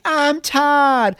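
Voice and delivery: high-pitched hammed-up scream; mock screaming. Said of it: "They're both screaming".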